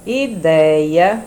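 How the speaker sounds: "Ideia" drawn out loud, DEI held; stressed read-aloud. Shown as a demonstration. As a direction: stretch "Ideia" with a rising end. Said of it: "lê estas palavras".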